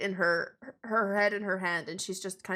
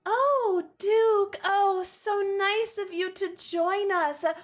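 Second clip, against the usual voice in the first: gushing society lady; 9 semitones higher, 4 dB louder than usual. Acted. Ladies approach the Duke at the party, high gushing greeting. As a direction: greet him with loud, sugary show. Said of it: "looking at the Duke and saying".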